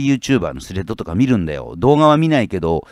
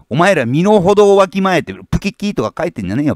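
angry shout; 8 semitones higher, 5 dB louder than usual. Real, not acted. Scolding jealous internet commenters.

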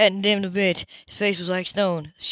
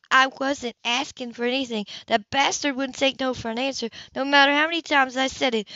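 angry woman; 6 semitones higher than usual. Acted. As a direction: defend yourself angrily. Grace furious.

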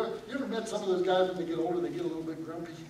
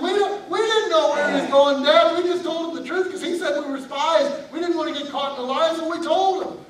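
high, loud protest; defensive sons; 8 semitones higher, 7 dB louder than usual. Acted. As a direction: shout the excuse back at Dad. Sons defend themselves to Dad.

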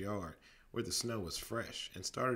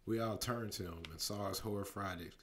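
ordinary delivery

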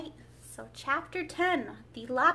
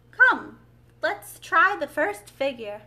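loud, higher, eager invitation; rally a partner to dance; urging; 5 semitones higher, 12 dB louder, slower than usual. A narrator's reading. Mock Turtle urges Gryphon to dance.

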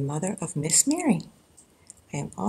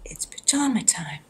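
whispered bedtime refrain; 5 dB quieter than usual. Acted. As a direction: breathe it out as a whisper. After "Hush, hush.

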